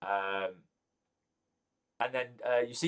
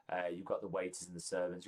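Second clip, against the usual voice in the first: ordinary talk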